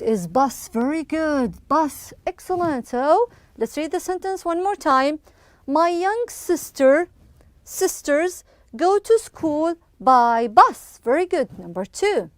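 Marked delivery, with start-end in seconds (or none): 5.7-11.0 slow, deliberate classroom reading; slow model reading. Shown as a demonstration. Teacher rereading the sentence for pupils, slowly and deliberately. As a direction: stretch each phrase and pause between them.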